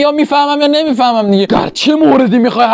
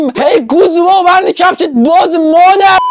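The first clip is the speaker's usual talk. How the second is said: mock rowdy call; 7 semitones higher, 3 dB louder than usual. Boys greeting each other rudely.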